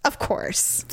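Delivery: breathless sarcastic whisper; whispered aside. Retold or imitated. Muttering at the shopper in a sarcastic whisper. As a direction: hiss it under your breath, mocking. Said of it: "I'm like".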